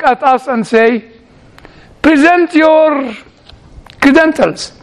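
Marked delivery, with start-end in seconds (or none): 2.0-4.7 slow, higher demand, word by word; lightly voiced stern official. Society to the candidate, slow formal demand.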